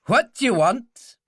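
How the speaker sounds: unfriendly Scots challenge, loud, high and slow; hard, suspicious Scots gatekeeper. A narrator's reading. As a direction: demand it in a hostile Scots accent. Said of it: "He had a hard Scots voice".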